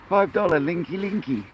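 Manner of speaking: high, sing-song joking hawker's call; mock sales pitch. To the chat, joking about charging for links, a high mock pitch. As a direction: tease the chat like a market trader. Jokingly hawking paid link drops.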